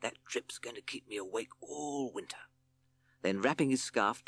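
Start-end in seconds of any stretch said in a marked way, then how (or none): 0.0-2.5 softer, hissing snake grumble; a snake complaining under his breath; Hissing Sid fretting about the leak; hissed. Acted. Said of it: "hissed Sid".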